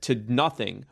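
normal talking voice

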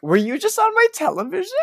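high, loud astonished question; amazed texter. Retold or imitated. Friends texting at Christmas, high and loud in amazement. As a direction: act out a friend's disbelief. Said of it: "people being like".

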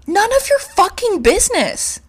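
high, loud snap; furious comeback; angry retort. Real, not acted. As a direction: bite it back hard. Angry retort about the doctor.

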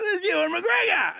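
high, loud shout of recognition; a pub full of rugby fans spotting Ewan McGregor, shouting excitedly. Retold or imitated. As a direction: call it out like a starstruck crowd. Pub crowd spotting a celebrity.

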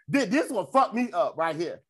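normal talking voice

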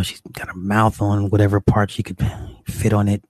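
normal talking voice

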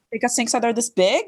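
"this big" lifted into a rising question; puzzled question rising on "big". Shown as a demonstration. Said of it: "if I have a question".

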